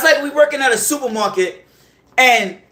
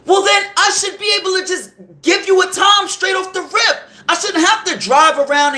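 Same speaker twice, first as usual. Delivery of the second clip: frustrated outburst; 7 semitones higher than usual. Real, not acted. Venting to listeners about a dispatcher who makes no sense, exasperated shout. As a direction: blurt it out fed up with the nonsense.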